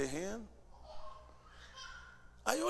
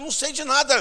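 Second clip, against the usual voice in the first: higher and louder protest; insist, flustered and raised; loud flustered denial; 10 semitones higher, 10 dB louder than usual. Retold or imitated. Preacher protesting to the pressing cashiers, raised and flustered.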